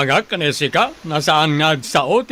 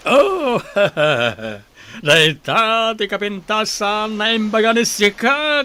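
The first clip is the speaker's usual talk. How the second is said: pleased laugh; 5 semitones higher than usual. Acted. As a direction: laugh with smug delight. Master laughs at praise.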